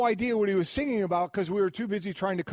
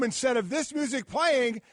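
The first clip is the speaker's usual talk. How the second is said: ordinary talk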